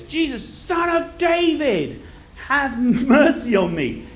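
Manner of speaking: high, slow drawn-out cry; shouting. Acted. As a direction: call out desperately, stretching each word. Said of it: "starts to start shouting".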